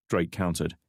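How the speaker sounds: ordinary delivery, nothing marked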